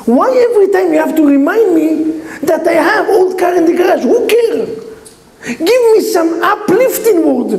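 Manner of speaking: high-pitched frustrated whine at mother; upset complaint. Retold or imitated. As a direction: plead like a deflated son. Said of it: "The guy was so upset with his mother".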